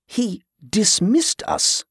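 bemused, drawn-out disbelief; amused disbelief. A narrator's reading. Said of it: "more amused than offended".

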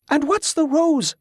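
very high-pitched character voice; curious questioner. A narrator's reading. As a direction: ask it in a high, lifted voice. Bela asks in figure's voice.

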